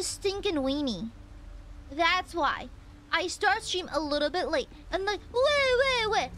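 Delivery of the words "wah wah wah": high, loud mock wail; mock crying. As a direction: bawl like a crybaby, teasing. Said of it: "Always crying".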